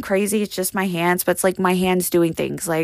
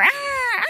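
very high ah-ah mimicking an emergency alert; high imitated alarm tone; imitated phone alarm. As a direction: mimic the piercing alert beeps. Phone alert wakes her at 3am.

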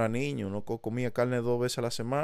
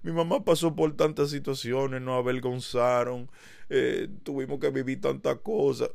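higher mock whiny complaint about a hard childhood; mock self-pity; 6 semitones higher than usual. Mocking how he could complain about his barrio, whiny. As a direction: play the poor victim, half-mocking. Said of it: "yo pudiera ahora mismo estar diciendo".

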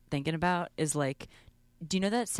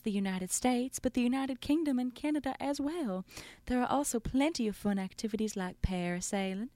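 a hint of a fancy Southern belle, higher; 5 semitones higher than usual. Host reading the student's quote aloud, as a fancy Southern belle. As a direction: read it like a genteel aristocratic Southern lady, pitched up. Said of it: "like a fancy Southern belle".

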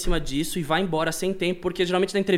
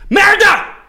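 startled shout; 14 semitones higher, 11 dB louder than usual. Retold or imitated. Retold startled outburst in scene.

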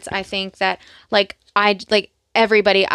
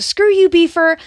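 high, loud quoted outburst; raised-voice imitation of a screaming match; mock scream; 10 semitones higher, 6 dB louder than usual. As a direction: yell it as an acted insult. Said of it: "they're, like, screaming at each other".

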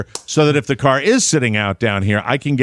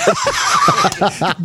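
high-pitched helpless laughter; real cackle, pitched way up; laughing fit. Real, not acted. Host cracks up at his curl question, high laughter.

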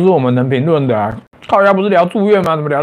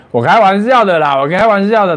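usual speaking voice

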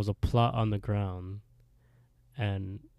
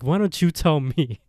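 retold angry retort, higher and louder; pissed off; 6 semitones higher, 8 dB louder than usual. Retelling Erica challenging Johnny in the cemetery, loudly pissed off. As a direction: throw the question back, mock-angry. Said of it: "Erica's really pissed off".

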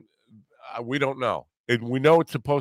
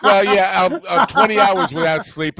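loud, high, talking through laughter; real laughing; 9 semitones higher, 8 dB louder than usual. Real, not acted. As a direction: protest while cracking up laughing. Cracking up at the retold insult.

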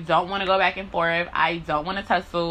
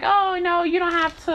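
high, light mocking of people brushing her off; mock reassurance; 10 semitones higher than usual. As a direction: echo them in a higher put-on voice. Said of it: "they'd be like".

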